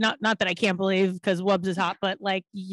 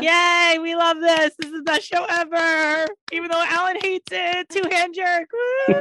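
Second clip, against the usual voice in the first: excited cheering; 7 semitones higher, 4 dB louder than usual. Real, not acted. Celebrating show ending.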